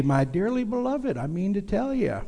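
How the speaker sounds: high-pitched folksy preacher impression; imitated Bible teacher's catchphrase, swooping high; J Vernon McGee. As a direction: lift the voice high, imitating an old radio preacher. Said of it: "old J Vernon used to say".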